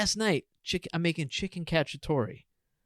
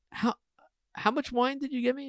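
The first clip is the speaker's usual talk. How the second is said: shocked question; 11 semitones higher than usual. Retold or imitated. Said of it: "And I was like".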